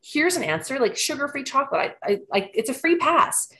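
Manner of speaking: thrilled like finding a loophole; retold excitement. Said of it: "And I'm like".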